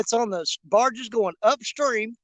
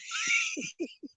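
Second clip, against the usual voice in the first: high, soft wheezy laugh; 8 dB quieter than usual. Real, not acted. Angler cracking up at his own story, high wheezy laugh.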